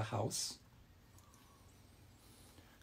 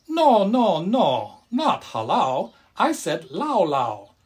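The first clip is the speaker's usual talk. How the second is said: much higher, loud no, then slow correction; exclaiming grandma; 6 semitones higher, 7 dB louder, slower than usual. A narrator's reading. Grandma corrects grandpa's mix-up, high and slow. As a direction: cry out no, then spell it out slowly. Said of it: "Grandma exclaimed".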